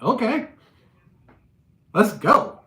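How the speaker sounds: thrilled to finally get into it; excited. Retold or imitated. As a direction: jump at the chance to fight. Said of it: "I got excited".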